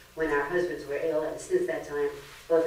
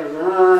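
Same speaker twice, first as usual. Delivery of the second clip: a short, loud, sapped "Whoa,"; drained whoa; 12 dB louder than usual. Retold or imitated. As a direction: sound like someone just drained you. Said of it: "they go".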